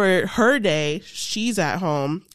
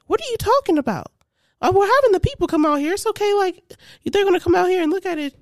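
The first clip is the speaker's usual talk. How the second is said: much higher, fast dismissive mom; mom brushing it off; 11 semitones higher, 3 dB louder, faster than usual. Retold or imitated. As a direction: wave the kid off, pitched up and quick. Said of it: "My mom's like".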